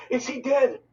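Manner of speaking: high panicked cry; a scared boy crying out about his brother; cried out. A narrator's reading. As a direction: blurt it in terror. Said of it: "I cried out".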